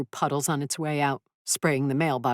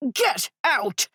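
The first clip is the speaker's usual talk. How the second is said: shouting; 10 semitones higher than usual. Acted. Luna ordering him away.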